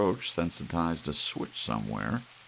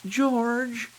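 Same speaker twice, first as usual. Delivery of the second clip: much higher, loud anguished cry; 6 dB louder than usual. Acted. Wife crying out to George after fleeing, loud. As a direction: cry out his name.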